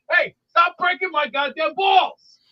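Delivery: angry, slow, heavy-hit shout; raging Italian. Acted. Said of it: "go like the raging Italian on Instagram".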